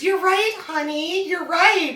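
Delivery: high, loud sing-song "you're right, honey"; mock the yes-dear husband; hammed-up agreeable husband; placating husband. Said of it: "every man just goes".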